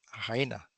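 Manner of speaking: "a hiena" with a ja sound on the h. Shown as a demonstration. To the class, showing the H that sounds. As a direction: rasp the h of "hiena" like ja. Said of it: "aquí sí suena la H como ja".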